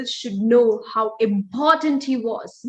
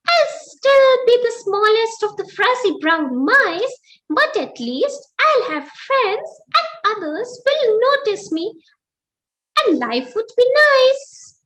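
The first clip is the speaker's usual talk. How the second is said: high, eager squeaky mouse voice; bursting with a bright idea; excited mouse; 9 semitones higher, 4 dB louder than usual. Acted. Mouse jumping out of bed, excited squeak. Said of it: "He said".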